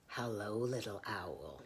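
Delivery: low, sly whispered greeting; sly fox whisper. Acted. Fox whispers to the trapped owl, sly. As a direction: purr it like a hungry fox. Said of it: "he said".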